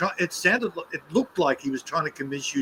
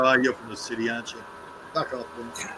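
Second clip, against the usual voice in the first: scornful aside; 7 semitones lower, 7 dB quieter than usual. Retold or imitated. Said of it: "you go".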